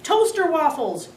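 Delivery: shouting over a loud TV. A narrator's reading. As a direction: shout it back over the noise. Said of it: "Dad yelled louder".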